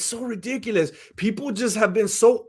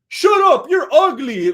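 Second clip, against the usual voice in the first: loud, high mock yell; imitated kid snapping back; bratty kid retort; 8 semitones higher, 8 dB louder than usual. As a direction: yell it like a rude little boy. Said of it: "turned around and told George".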